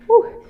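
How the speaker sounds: breathless whew. Real, not acted. Winded after dancing.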